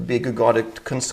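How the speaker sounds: ordinary talk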